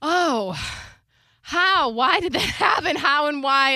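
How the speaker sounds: high, loud bewildered questions; mock amazement. Retelling her reaction to partner's refund news, high bewilderment. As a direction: act floored, pitched well up.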